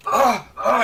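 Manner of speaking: loud frantic yelps; panicked cries. Acted. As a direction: cry out in terror. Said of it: "he starts to thrash about".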